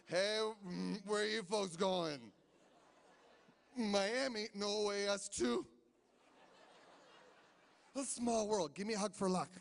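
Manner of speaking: loud, overfriendly tipsy chatter; a hammed-up drunk pilot greeting travellers; slurring pilot hugging strangers for luck. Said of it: "dress up like a commercial airline pilot".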